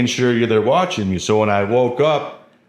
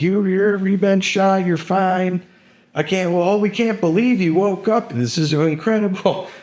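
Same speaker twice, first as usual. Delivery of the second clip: higher, excited disbelief; amazed hospital staff; 10 semitones higher than usual. Retold or imitated. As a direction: gush with amazement at the waking patient. Staff amazed patient woke.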